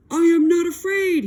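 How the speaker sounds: high, loud cry of false bravado; high loud toad insisting he is brave; frightened cry. A narrator's reading. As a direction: shout it shakily, pretending not to be scared. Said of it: "he cried".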